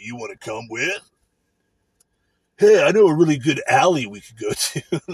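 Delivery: mocking a sleazy coworker, lightly hammed; mocking douchey voice. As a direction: ham up a creepy flirt to mock him. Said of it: "using his douche, douchey voice".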